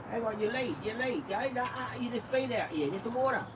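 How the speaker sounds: high, sing-song voice for the dog; doggy talk. Acted. Owner coaxing his dog to find the ball, high sing-song. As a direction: coax the dog like a pet owner.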